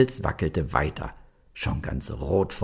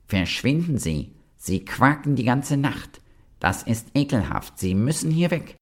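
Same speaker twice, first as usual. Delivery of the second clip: grumpy toadstool; 6 semitones higher than usual. A narrator's reading. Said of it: "da sagte der Giftpilz".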